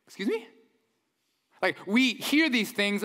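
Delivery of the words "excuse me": very high, incredulous squeak of a question; taken aback. Acted. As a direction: react in disbelief, pitch leaping up. Voicing shocked hearers of Jesus' claims.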